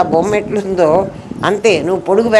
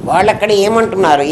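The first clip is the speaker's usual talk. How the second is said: usual speaking voice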